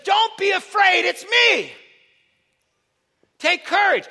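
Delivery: high, loud shout; speaking out through night. Acted. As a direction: call out across wind and darkness. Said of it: "immediately speaks out through the night".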